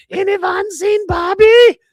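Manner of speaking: very high, loud, drawn-out falsetto call; hammed-up falsetto holler, stretched slow; imitated woman calling out. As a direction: sing out the question in a silly high voice. Acts out woman calling for Bobby.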